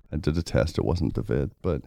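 hoarse, congested sick voice; talking through a bad cold. Real, not acted. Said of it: "if you guys hear me hacking".

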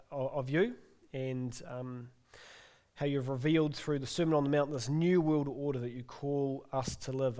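soft, hushed prayer voice; closing prayer. Acted. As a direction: pray quietly to close the service.

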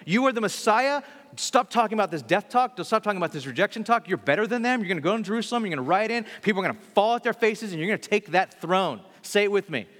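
high, loud pep-talk rebuke; rally him hard and loud; forceful rebuke. Retold or imitated. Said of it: "pulls him aside and is like".

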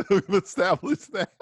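laughing while echoing the line. Real, not acted. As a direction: repeat it through a laugh. Repeats friend's line laughing.

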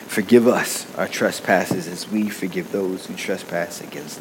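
soft, low, reverent prayer voice. Acted. Leads congregation in prayer.

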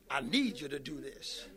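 God's word gently telling the believer what he needs, hushed. Acted.

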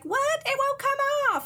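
very high, hammed-up alarm; high-pitched cartoon panic; mock panic. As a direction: squeak it out as if stuck. Masuko panics, mask is stuck.

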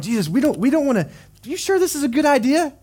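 rising, pleading doubt; worried disciples. Retold or imitated. Disciples pushing back at Jesus, nervous and pleading. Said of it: "The disciples are like".

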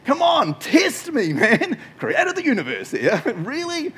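high, loud, teasing God voice; mighty creator scoffing; cocky God. Retold or imitated. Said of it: "what I imagine God's response being".

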